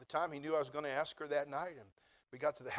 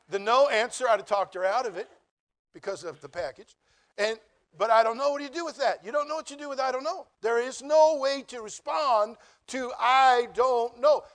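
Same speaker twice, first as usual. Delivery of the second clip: higher and louder, building frustration; exasperated; 7 semitones higher, 10 dB louder than usual. Real, not acted. Preacher working himself up over her answer. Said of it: "rising exasperation in sermon".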